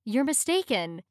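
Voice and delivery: usual speaking voice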